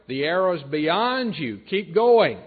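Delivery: high, loud call, building to the end; shouted call. Acted. Archer shouting a signal to the boy, shouted call. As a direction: shout it slowly across a distance, hardest on the last words. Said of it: "if he shouted to the boy".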